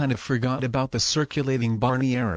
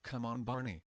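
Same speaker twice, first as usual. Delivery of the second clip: much softer, grumbling under his breath at the screen; muttering to himself; 15 dB quieter than usual. Acted. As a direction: complain quietly, almost to yourself. Paul grumbling alone at his computer.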